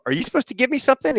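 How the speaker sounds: high, fast, querying voice; retold puzzled question. Pilot asks ramp guys about sandwich, retold puzzlement. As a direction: re-enact asking in bafflement.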